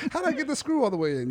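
mocking clueless asker. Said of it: "mocking clueless people".

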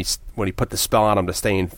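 usual speaking voice

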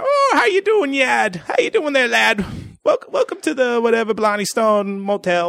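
high, hammed-up jolly Irish innkeeper accent. Motel keeper greeting the couple, jolly mock Irish accent. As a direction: play a cheery Irish motel keeper with a thick brogue. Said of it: "the jolly old Irish fucking keeper".

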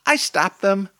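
put-on high, loud toddler voice. A narrator's reading. Toddler offers to stop the seagulls, high little-kid voice. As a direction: pipe up like a small child. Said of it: "says the toddler".

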